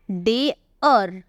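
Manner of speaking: डेयर very slow, split into two parts; drawn-out pronunciation. Shown as a demonstration. Teacher showing how the word is read, slow sounding-out. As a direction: stretch डेयर as "day-ur". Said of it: "लेकिन हम इसे पढ़ रहे हैं क्या?".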